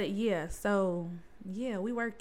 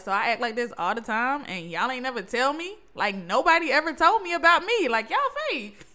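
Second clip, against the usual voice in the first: mock-outraged, louder and higher as it goes; indignant retelling; 3 semitones higher, 7 dB louder than usual. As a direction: build to a loud, high-pitched complaint. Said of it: "I was like".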